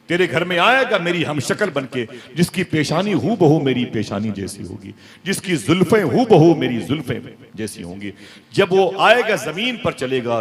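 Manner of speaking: high, loud impassioned preaching; preaching at full force. Acted. Preacher voicing the Prophet's promise to Hussain, loud impassioned preaching. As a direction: cry it out to the whole gathering.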